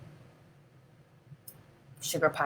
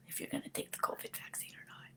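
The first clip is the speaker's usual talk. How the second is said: whisper; 22 dB quieter, faster than usual. Real, not acted. Whispered aside about vaccine.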